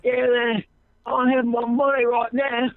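cockney thug saying a silly threat; mock cockney gangster. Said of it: "Over there".

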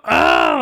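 a short retold scream from the bathroom; imitated scream. Michael in the bathroom screams out, a loud high mock yelp. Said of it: "like, scream".

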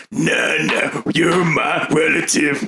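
taunting character voice. Acted. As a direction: drag it out to rub it in.

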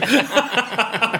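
a high burst of laughter; a bright laugh breaking out. Real, not acted. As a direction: laugh freely at the memory. Laughing at the memory.

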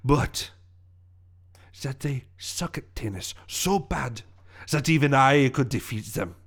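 villain monologue in a put-on French accent; accented villain revealing his master plan. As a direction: savour your revenge scheme, with pauses.

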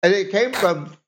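talking while chewing candy; words round a mouthful; mouth full. Real, not acted. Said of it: "I can hear everything you're saying and chewing".

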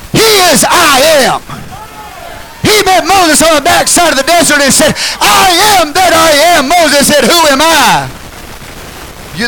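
loud, high preaching build; fiery pulpit preaching; full-force preaching. Real, not acted. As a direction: preach it out to the congregation.

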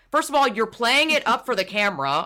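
usual speaking voice

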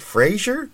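"Fraser" with a zh in the middle, rising high as a question; questioning mispronunciation. Retold or imitated. Co-star asking about the name, "Fraser" said with zh. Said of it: "Sandler asked him, So is it Fraser".

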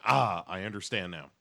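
normal talking voice

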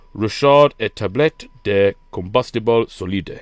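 slow French reading of "Richard et tablette de combustible solide". Shown as a demonstration. Reading the ration pack's French side, slow French accent. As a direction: read it out slowly like a Frenchman. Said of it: "on the other side is".

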